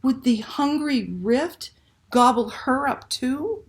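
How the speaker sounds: plain reading voice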